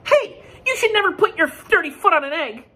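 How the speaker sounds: very high, loud, sharp scolding. Acted. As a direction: tell him off like a bossy duck. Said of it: "Duck scolded".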